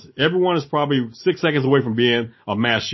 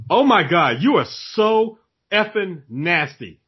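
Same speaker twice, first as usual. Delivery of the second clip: higher, louder and slow, appalled; retold indignation; 6 semitones higher, 3 dB louder, slower than usual. Wife turning on a rude customer, acted indignant rebuke. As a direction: act out a scolding with drawn-out disgust.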